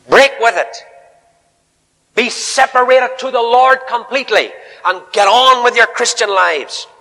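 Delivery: high, loud, ringing sermon call; full-force preaching. Acted. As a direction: preach it out to the whole hall.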